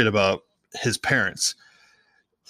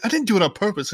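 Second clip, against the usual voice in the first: Harry defensive; 10 semitones higher, faster than usual. Retold or imitated. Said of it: "And Harry's like".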